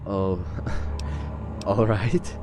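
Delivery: ordinary talk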